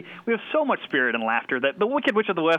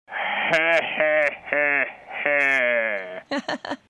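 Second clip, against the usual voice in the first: Frankenstein laugh. Acted. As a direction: heave out a monster laugh. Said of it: "he laughs like this".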